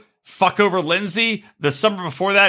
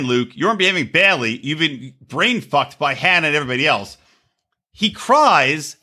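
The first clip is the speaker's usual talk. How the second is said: ordinary delivery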